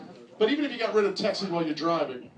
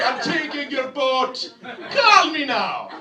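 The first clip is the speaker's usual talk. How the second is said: loud, high mock Jamaican accent; Miss Cleo; 7 semitones higher, 8 dB louder, slower than usual. Pirate taking a boat like a TV psychic, mock Jamaican accent. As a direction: belt it out slowly in a hammed-up Jamaican accent. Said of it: "I really mean Miss Cleo".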